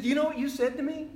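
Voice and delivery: high, loud mock voice for his friend Marty; retold friend. Old friend Marty reminding Sam of a past remark, in a high, loud teasing voice.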